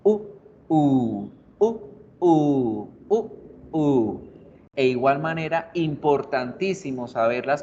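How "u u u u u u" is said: alternating short and long U vowels; short U then long U. Shown as a demonstration. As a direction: clip one U, stretch the next. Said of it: "una la hago cortísima y la otra la extiendo".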